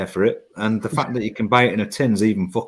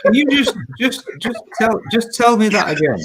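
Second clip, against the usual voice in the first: amused disbelief; 8 semitones higher than usual. Real, not acted. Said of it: "asks for a repeat, high".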